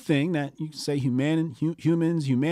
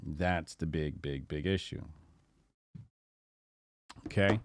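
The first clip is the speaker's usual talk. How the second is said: normal talking voice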